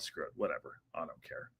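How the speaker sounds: quiet throwaway mumble; muttered aside. Real, not acted. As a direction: mumble it off to yourself.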